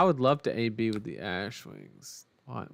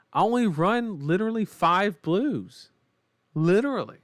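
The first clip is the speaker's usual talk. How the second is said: exasperated rant; 7 semitones higher, 5 dB louder than usual. Real, not acted. Ranting about the odds.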